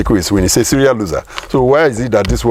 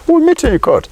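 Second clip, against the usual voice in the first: much higher, mocking the rivals' swagger; mock confident taunt. Mimicking rivals who dare him to court, mock confident taunt. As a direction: toss it off like a cocky challenge. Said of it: "They are very confident".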